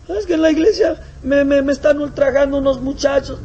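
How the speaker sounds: very high, loud whimper; whining complaint. Acted. Brother whines to missionary about bullies, very high whimper. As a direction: whine like a hurt child.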